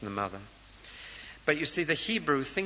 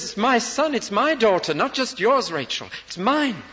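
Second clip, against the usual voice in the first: much higher, much louder, impassioned declaration; God's fierce claim; 9 semitones higher, 8 dB louder than usual. Acted. God insists to Rachel the children are his, loud and high. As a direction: shout it out, fiercely possessive of these children. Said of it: "He says".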